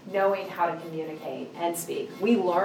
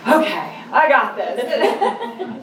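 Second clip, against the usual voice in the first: laughing self pep talk; 5 semitones higher, 6 dB louder, much slower than usual. Retold or imitated. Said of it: "And I was like".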